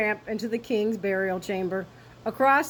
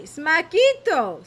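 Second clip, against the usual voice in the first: slow, louder punchy sound word; comic smack; 6 semitones higher, 6 dB louder, slower than usual. A narrator's reading. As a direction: land the crash comically. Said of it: "sound effect word".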